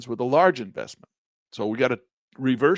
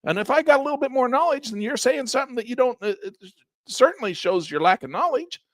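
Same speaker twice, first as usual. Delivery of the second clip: retold retort; 10 semitones higher, 4 dB louder than usual. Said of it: "And I'm like".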